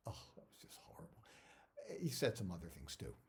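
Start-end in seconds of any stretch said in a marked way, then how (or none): 0.1-1.2 breathy whisper, no voice at all; whispered aside. Retold or imitated. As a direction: whisper it so the actor can't hear. Director's aside to crew.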